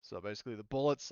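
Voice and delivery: normal talking voice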